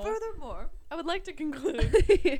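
loud, slow mock professor, laughing; posh oxford professor. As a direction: ham up a pompous lecturer.

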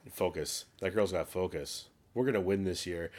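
low-pitched imitation of a movie coach; volleyball coach impression. Host redoing the movie coach's line, in a low imitation. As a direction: drop the voice to mimic the coach. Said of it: "as authentically as he did".

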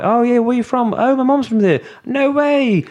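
London accent, hammed up and high. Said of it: "if I'm talking from London, more high".

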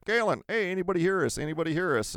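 retold high-pitched hail; calling out. Driver hailing lost friends on the walkie-talkie, calling out.

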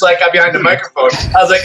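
ordinary talk

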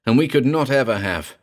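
ordinary delivery, nothing marked

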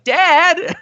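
a high, loud cry of "Dad!" as the daughter left behind; retold desperate yell. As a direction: call after the departing car. Retells daughter calling after car.

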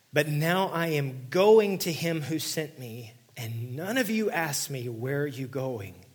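loud, slow, ringing proclamation; preacher thundering the verse; forceful scripture reading. Acted. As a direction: read it out like a prophecy.

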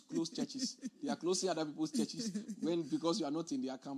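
amused, chuckles breaking into the words; laughing while talking. Real, not acted. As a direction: chuckle through the remark. Chuckles while mocking rival churches.

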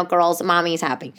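ordinary delivery

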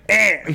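put-on short, high kid's "eh"; little "eh" noise. Dad imitating his son caught in trouble, little "eh". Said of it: "he just does this little".

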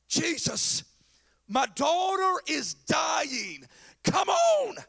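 slow, high, building to a shout; desperate plea. Acted. Jairus begs Jesus for his dying daughter, desperately.

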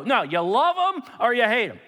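high, loud, emphatic comeback; uncompromising retort. Acted. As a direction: cut off the objection firmly. Acted John rejecting the objection.

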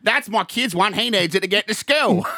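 high-pitched comic comeback; mock protest. Kangaroo rider explaining himself to bartender, high mock protest. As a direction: answer back high, hamming it up. Playing the man answering the bartender.

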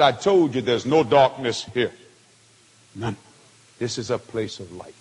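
low, grave pronouncement with pauses; solemn angelic rebuke. Retold or imitated. Voices the angel's solemn answer.

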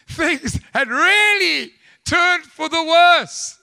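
loud, high, slow proclamation; slow, booming pulpit emphasis; emphatic preaching. Real, not acted. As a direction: drive each phrase home to the congregation. Preaches at full force.

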